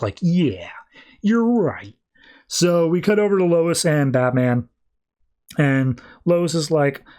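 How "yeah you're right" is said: airy, smirking agreement, acted. Superman agrees with Catwoman, smirking. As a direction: give in with an audible smirk. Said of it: "like, the smirk".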